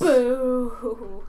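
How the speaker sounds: booing like a crowd; mock booing. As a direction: boo it like a disappointed fan. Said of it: "others were like".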